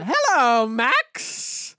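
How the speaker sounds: loud, high theatrical witch greeting; shrieky singsong villainess; Winifred Sanderson. Acted. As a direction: call out like a hammy movie witch. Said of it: "Winifred Sanderson".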